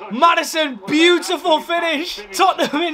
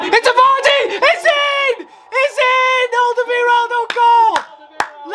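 goal scream; 9 semitones higher, slower than usual. Real, not acted. Fan to viewers as the third goal goes in, a very high ecstatic scream.

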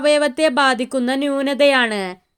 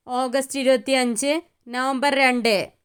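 ordinary talk, nothing marked

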